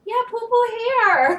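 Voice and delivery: put-on high, loud child voice; little girl voice. Girl answers her dad, high and loud. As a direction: chirp it like a proud toddler. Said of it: "She was like".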